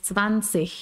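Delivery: "zwanzig" with a slow dragged ch ending, tsvan-tsich; final ch sound. Shown as a demonstration. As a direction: let the end of "zwanzig" hiss out as ch. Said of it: "ellos arrastran como una C H".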